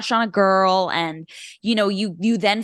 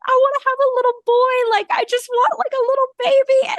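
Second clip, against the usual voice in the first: much higher, louder, quick gush; broody squeal; 5 dB louder than usual. Retold or imitated. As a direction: coo with sudden longing. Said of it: "part of me that was like".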